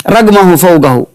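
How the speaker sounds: ordinary talk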